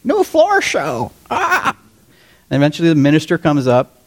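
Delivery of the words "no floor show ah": high, slow parrot squawk. Acted. Bar parrot heckling the church worship team, high squawk. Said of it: "you hear the parrot go".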